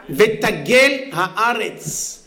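slowly savored verse. Acted.

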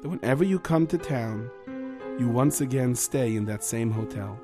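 gentle Rav voice. Acted.

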